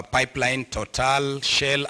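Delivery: ordinary talk, nothing marked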